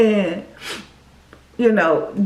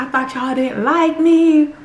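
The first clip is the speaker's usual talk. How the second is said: teary disbelief; 4 semitones higher, 3 dB louder, slower than usual. Retold or imitated. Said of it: "I was just crying".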